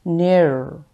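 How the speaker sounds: निर with a full, thick r; heavy r. Shown as a demonstration. Teacher showing the heavy r in निर. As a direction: roll निर heavy and round. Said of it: "इसे मोटा और पुर पढ़ा जाता है".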